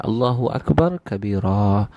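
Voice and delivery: slow recitation with long-held endings; prayer recitation. Shown as a demonstration. Preacher explains the prayer's meaning, reciting slowly. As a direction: recite slowly, drawing out each phrase. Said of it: "Ha, kita baca tu".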